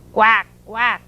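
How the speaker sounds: short, punchy duck quacks; lightly voiced duck. A narrator's reading. Mother Duck complains at the truck, light duck quacks. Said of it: "said Mother Duck".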